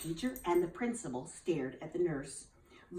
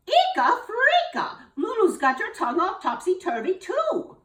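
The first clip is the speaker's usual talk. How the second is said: very high, loud gasp of alarm; shocked cry; 13 semitones higher, 8 dB louder than usual. Acted. Missus Bell hears the principal rhyme, shrieking in shock. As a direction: squeal it out in alarm.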